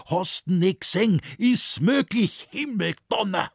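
high, loud, aghast dialect rant; exasperated shout, pitched right up. A narrator's reading. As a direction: yell in disbelief at your son. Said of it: "So schreit nur nicht so".

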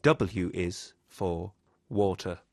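usual speaking voice

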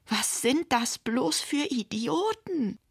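high, slow, swooping indignation; exasperated outrage. A narrator's reading. Tamara has just read the burglars' note, outraged. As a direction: stretch it out in exasperated disbelief.

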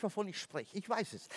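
confession murmurée. Acted. Leise Beteuerung.

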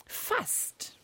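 breathy whisper. Real, not acted. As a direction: whisper it like a secret. Teasing hint about the riddle.

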